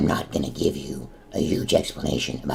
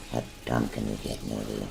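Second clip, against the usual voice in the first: ordinary talk, nothing marked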